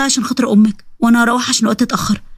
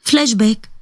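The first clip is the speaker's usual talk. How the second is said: ordinary delivery, nothing marked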